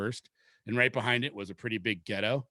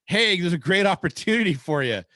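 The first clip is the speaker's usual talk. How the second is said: lightly mocked boss pitch, higher and louder; salesy boss; 6 semitones higher, 5 dB louder than usual. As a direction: imitate a manager selling a bad deal. Boss pitching a bad shift.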